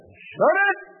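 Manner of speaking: sudden high shout; sharp command. Acted. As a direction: cut the accuser off sharply. Judge silencing Satan.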